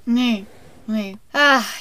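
usual speaking voice

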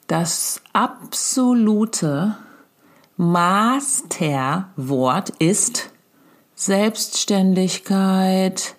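very slow, each word set apart with pauses; grand announcement. Shown as a demonstration. Mother proclaims her big parenting rule to Else, in a very slow, solemn announcement. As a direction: announce it like the proclamation of the millennium, slowly. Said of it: "Ansage des Jahrtausends".

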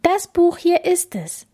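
put-on high-pitched firm girl; insistent Bella. Bella defending her book to the king, insistent.

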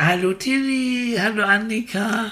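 put-on high, laughing, gleeful child voice; joyful. Guide voices the child waving from the carousel, gleeful.

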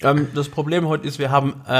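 ordinary talk

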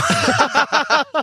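high burst of laughter. Real, not acted. Laughing at his own Schwindelanfälle joke, high burst. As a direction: crack up, high and bright.

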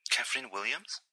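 small, squeaky voice on the phone; piepsige Frauenstimme. A narrator's reading. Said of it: "eine etwas piepsig klingende Frauenstimme". (leise, etwas piepsige Frauenstimme am Telefon)

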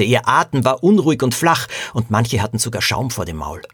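ordinary delivery

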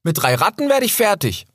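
very high, cocky cub voice; young fox boasting he can handle three rats; proud young fox. Acted. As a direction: brag like a brave little fox. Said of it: "sagte Rix da stolz".

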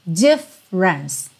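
DIFFERENCE split slowly as "DIF - rence"; two attacks. Shown as a demonstration. Teacher has students think two syllables, slow and split. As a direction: break DIFFERENCE into two beats, middle E dropped. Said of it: "dois ataques de som".

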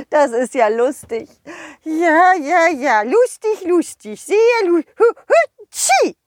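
high giggle breaking into a sneeze; laugh, then sneeze; giggling snowman. Acted. (der Schneemann kichert hoch und niest dann)

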